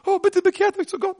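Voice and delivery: mock timid preacher. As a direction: parody a meek little preacher. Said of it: "Sie haben nicht leise gepredigt".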